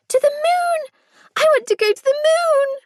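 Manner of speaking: put-on high, loud child's cry of delight; excited little girl. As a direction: shriek with joy like a tiny girl flying. Small child on swing shouting.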